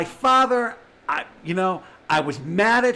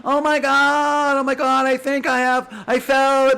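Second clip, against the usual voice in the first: mock dramatic parent; 8 semitones higher, 5 dB louder than usual. Mimicking parents' hospital dramatics.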